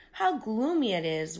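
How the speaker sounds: ordinary delivery, nothing marked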